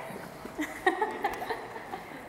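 high breathy giggle of nerves; a giggle before the vows; nervous laugh. Real, not acted. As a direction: let a shaky laugh slip out.

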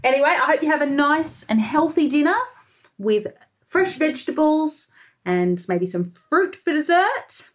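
ordinary delivery

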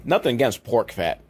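usual speaking voice

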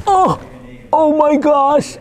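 high strained gasp while lifting; straining to lift. Real, not acted. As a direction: cry out under a heavy load. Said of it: "This is so heavy".

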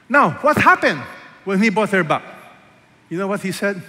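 loud, high preaching build-up. Acted. Pastor stirring the congregation, loud and high preaching. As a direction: rise up and call it out to the church.

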